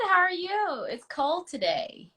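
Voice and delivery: normal talking voice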